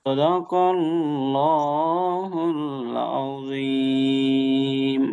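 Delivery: slow, melodic tajweed recitation; measured teacher's recitation; Quran recitation. Shown as a demonstration. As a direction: chant it with long, drawn-out vowels. Recites the sura's closing verse.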